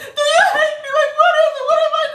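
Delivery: very high, loud, crying mock wail; dramatic wounded lady. Coworker wailing her hurt at the narrator. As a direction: wail it like a betrayed drama queen. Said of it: "she's like this".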